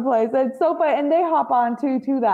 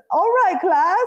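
a hint of a put-on English accent; 8 semitones higher than usual. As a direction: slip back into the fake British lecturer voice. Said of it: "goes back to his accent".

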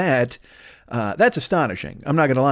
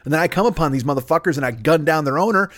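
usual speaking voice

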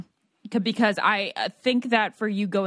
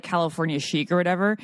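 normal talking voice

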